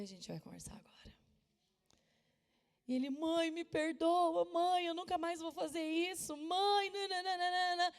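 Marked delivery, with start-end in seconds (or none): 0.0-1.2 cold whisper, much softer; hushed, ominous reply to her son; menacing whisper. Retold or imitated. Mother answers her son in whisper. 3.1-7.9 lightly voiced whining child, higher; pleading child. Son begging forgiveness.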